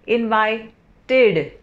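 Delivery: इनवाइटेड slow, with a clear final id syllable; id ending. Shown as a demonstration. Teacher slowly showing students the id sound in इनवाइटेड. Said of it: "इड का sound आएगा".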